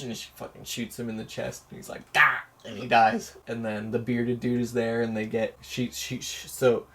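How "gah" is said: dying grunt. Retold or imitated. He is shot in the chest.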